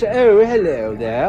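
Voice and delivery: slow, drawn-out upper-class English; mimicking a posh Englishman, slowly; posh English impression. Podcast host mocks the posh English accent. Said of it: "people who sound like Prince Charles".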